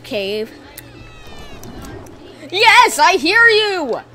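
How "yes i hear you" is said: excited outburst. Real, not acted. Excited reaction to game.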